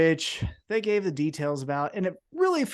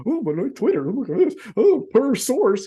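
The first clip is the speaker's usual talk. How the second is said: mocking nerd voice; 6 semitones higher, 4 dB louder than usual. Mocking reporters.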